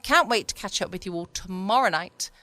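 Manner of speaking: normal talking voice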